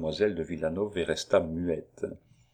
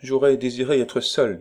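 put-on cool young woman, voice raised; chilly rebuff, pitched up; cold, almost hard; 5 semitones higher, 5 dB louder than usual. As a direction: state it firmly to an unwelcome visitor. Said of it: "dont la voix était froide et presque dure".

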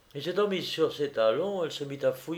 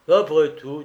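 said out loud, louder; aloud; 3 semitones higher, 9 dB louder than usual. Acted. Petouchkov talking to himself in his room, louder. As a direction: push it out, suddenly decided. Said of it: "dit-il à haute voix".

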